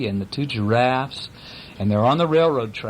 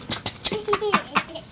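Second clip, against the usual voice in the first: very high soft giggle; tickled squeal; 4 dB quieter than usual. Retold or imitated. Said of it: "They go".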